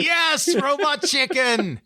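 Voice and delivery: excited shout. Real, not acted. Recognizing the show, excited.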